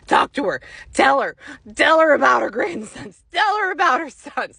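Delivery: loud, pushy imitation of a spirit; relentless nagging. Grandmother's spirit badgering the psychic, insistent.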